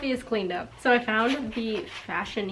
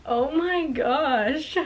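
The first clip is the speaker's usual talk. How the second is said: shocked; 4 semitones higher, much slower than usual. Real, not acted. Shocked at the diary news.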